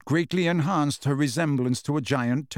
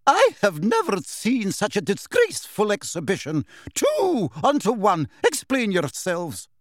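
high, outraged teacher's reprimand; indignant dressing-down, pitched high; enraged scolding; 7 semitones higher than usual. A narrator's reading. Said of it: "quivering with rage".

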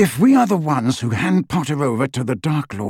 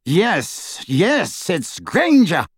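higher eager cry; cried; 6 semitones higher, much slower than usual. A narrator's reading. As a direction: blurt it out excitedly. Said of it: "cried Lucius".

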